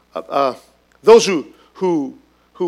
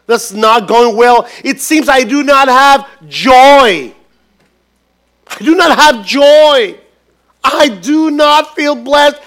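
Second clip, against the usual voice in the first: higher, louder and slow, crying out to God; anguished plea; 5 semitones higher, 6 dB louder, slower than usual. Acted. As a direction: drag out each word like a desperate prayer. Believer's prayer builds to a cry.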